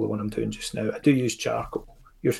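normal talking voice

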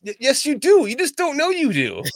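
high, loud, insistent objection; emphatic contradiction. Real, not acted. Friend denies having an accent, loud insistent contradiction.

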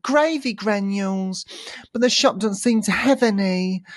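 high, insistent kid impression; imitating little boy. Boy explains his errand, high mimicked kid voice. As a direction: play the lad with pitch well up. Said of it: "Like that".

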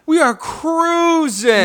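slow, high hype call; hyped drawn-out announcer. Acted. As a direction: stretch it out like a game-show host. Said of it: "announcer hype".

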